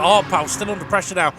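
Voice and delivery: brisk, upbeat results call down the finishing order; live race commentary. Acted. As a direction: call the placings like a trackside commentator.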